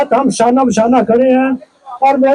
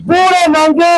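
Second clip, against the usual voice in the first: booming political rally speech; 4 semitones higher than usual. Real, not acted. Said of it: "मेरे नारों का जवाब देंगे".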